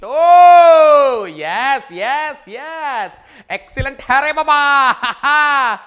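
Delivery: loud, drawn-out cheer; ecstatic praise. Real, not acted. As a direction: celebrate the answer loudly. Teacher cheering correct answer.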